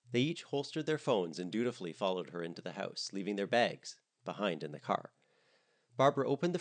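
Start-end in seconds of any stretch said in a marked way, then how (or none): none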